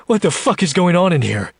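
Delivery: high, winded outburst; breathing heavily. Acted. Jack rushing in on the woman, winded and alarmed. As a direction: burst in demanding, out of breath. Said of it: "breathing heavily".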